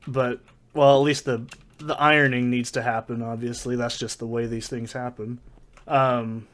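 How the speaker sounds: ordinary talk, nothing marked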